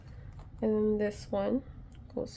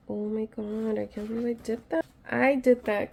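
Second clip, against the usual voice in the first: ordinary delivery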